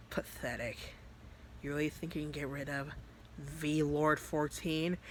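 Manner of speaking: soft, superior sneer; quiet disdain, drawing out the title at the end; contemptuous demon. Acted. Demon Vice after being punched, coolly contemptuous. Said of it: "Vice being Vice was like".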